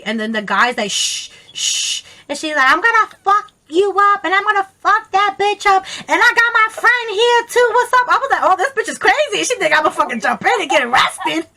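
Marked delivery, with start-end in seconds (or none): 0.9-2.0 imitated double shush. 2.7-8.1 high, loud, fired-up tirade; angry threats. Retold or imitated. Friend imitating angry woman threatening a rival, heated yelling. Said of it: "she was so angry". 8.4-11.5 high, hurried, incredulous blurt; retold alarm. Bystander realising friend expects her to jump in, alarmed.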